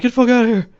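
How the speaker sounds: furious high shout that speeds up; angry outburst. Acted. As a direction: yell it high and loud, then rush the rest. Shield hero lashes out at Raphtalia.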